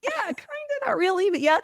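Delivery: ordinary talk, nothing marked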